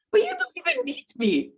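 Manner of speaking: high, laughing protest; high incredulous laugh; laughing disbelief. Retold or imitated. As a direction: say it through a laugh.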